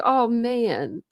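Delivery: usual speaking voice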